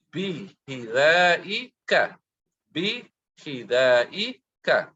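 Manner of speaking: slow, drawn-out drill of "Bi hidaika", each syllable stretched. Shown as a demonstration. Teacher drilling students slowly on "Bi hidaika". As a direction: spell it out slowly for the class. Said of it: "teacher drilling phrase slowly".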